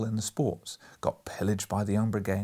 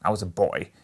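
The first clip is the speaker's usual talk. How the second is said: said impatiently; 3 semitones lower, 5 dB louder than usual. A narrator's reading. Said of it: "he said impatiently".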